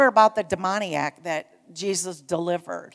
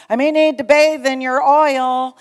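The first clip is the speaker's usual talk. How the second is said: loud, raised-pitch call across a room; imitated holler; 4 semitones higher, 13 dB louder than usual. Lady calls to preacher across the room, hollering. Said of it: "she hollered at me".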